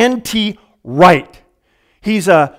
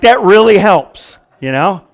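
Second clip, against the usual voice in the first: usual speaking voice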